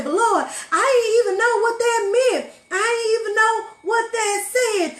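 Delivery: high, loud, tearful wail; shouted sobbing plea; crying plea to the Lord. Acted. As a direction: beg the Lord through tears at the top of your voice. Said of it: "stand before him and say".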